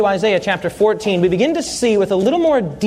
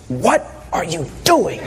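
wife's hushed question; 6 semitones lower than usual. Retold or imitated. Preacher imitating his bride hissing a puzzled question at the altar. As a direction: mimic the bride asking under her breath. Wife whispers during wedding.

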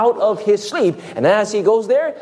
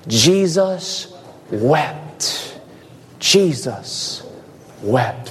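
slow reverent reading; 4 semitones lower, much slower than usual. Acted. Reverent reading of shortest verse.

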